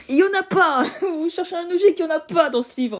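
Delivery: loud, fed-up half-laughing rant; exasperated outburst. Real, not acted. Exasperated rant about no logic.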